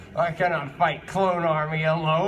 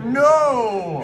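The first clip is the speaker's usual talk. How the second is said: shout; 5 semitones higher, 5 dB louder than usual. Acted. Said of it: "Shouted Mewtwo".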